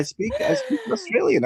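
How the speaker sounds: laughing. Real, not acted. Laughing after his chant.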